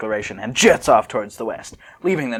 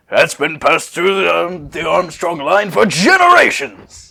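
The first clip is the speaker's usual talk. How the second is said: louder, slow, hammy booming; Armstrong imitation; 7 semitones higher, 8 dB louder than usual. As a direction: boast like a proud strongman. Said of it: "it's like".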